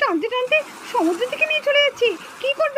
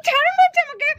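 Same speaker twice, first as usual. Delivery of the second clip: frightened cry; 5 semitones higher, 3 dB louder than usual. Acted. Grabbed by the kidnapper.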